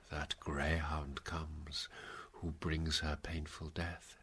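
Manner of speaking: slow, hushed foretelling; prophetic whisper. Acted. Prophecy of the greyhound.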